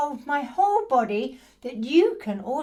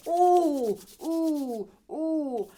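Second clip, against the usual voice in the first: pained ooh; 3 semitones higher, 4 dB louder, much slower than usual. Retold or imitated.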